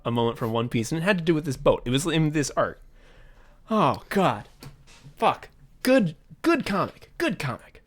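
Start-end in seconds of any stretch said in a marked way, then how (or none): 3.7-7.8 high, choked-up sighing praise; overwhelmed, short bursts of feeling; real emotional. Real, not acted. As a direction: struggle to keep composure. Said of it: "I'm getting emotional".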